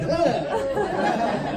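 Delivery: high startled ah with a laugh; mock shriek. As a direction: squeal like it shocked you. Reacts to smelly house.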